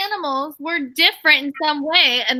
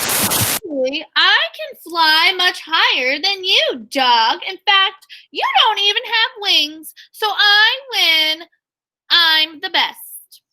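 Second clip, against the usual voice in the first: higher, slow, show-off ladybug voice; bragging ladybug; 5 semitones higher, slower than usual. Acted. Teacher voices the ladybug taunting the dog, higher and slow. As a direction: gloat over the dog, drawing out the win. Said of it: "And that was the ladybug".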